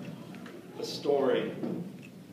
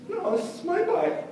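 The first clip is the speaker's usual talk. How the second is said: put-on much higher, slow kid's protest; small kid protesting; 13 semitones higher than usual. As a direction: hold on to your bike. Kid clinging to bike.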